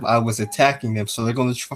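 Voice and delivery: ordinary delivery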